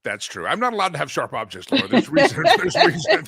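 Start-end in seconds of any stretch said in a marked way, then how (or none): 1.9-3.2 high, louder laughing through the words. Real, not acted.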